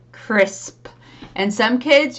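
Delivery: ordinary talk, nothing marked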